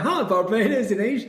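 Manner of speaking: high, confused question; puzzled surprise. Retold or imitated. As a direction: sound baffled. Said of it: "I'm like".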